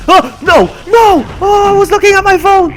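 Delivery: loud, high cry of dismay; dismayed shouting. Real, not acted. Streamer loses while checking the phone, dismayed shout. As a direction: wail it out at the top of your voice.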